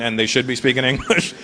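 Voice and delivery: ordinary talk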